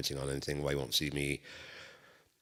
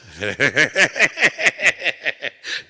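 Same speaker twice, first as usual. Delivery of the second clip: loud high laugh; 11 semitones higher, 14 dB louder than usual. Real, not acted. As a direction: crack up mid-line. Laughing at own story.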